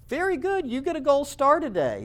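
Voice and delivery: put-on high sing-song praise, like to a child; patronizing teacher praise. Biology professors praise an obvious statement in a high patronizing voice. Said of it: "they're like".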